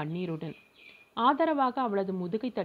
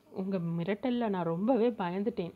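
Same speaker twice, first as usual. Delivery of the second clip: ordinary delivery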